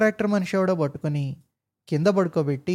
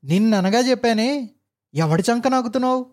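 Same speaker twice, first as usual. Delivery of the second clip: higher, louder angry bark at a clerk; shouted scolding; 6 semitones higher, 3 dB louder than usual. Acted. Officer yells at old clerk, angry shout. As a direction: boss yelling down at the old clerk. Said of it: "అరిచాడు".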